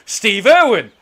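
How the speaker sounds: loud, high imitation of awed Americans; hammed-up American fan excitement; excited Americans. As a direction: shout it like starstruck American tourists. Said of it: "They go, they go".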